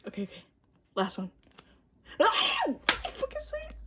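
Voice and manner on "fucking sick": very high, slow squeal; squealed fucking sick. Real, not acted. Fan pulls a great card, slow high squeal. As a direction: squeal it out in delight.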